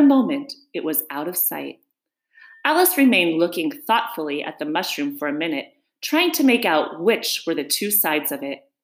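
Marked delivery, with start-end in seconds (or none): none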